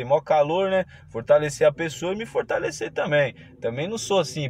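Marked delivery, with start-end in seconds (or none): none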